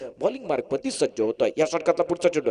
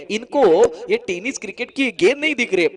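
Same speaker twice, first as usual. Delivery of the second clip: ordinary delivery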